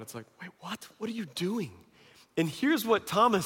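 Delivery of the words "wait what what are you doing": hushed, baffled disbelief. Retold or imitated. Disciples reacting to Jesus' odd answer, quietly baffled. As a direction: quietly question him, as if baffled.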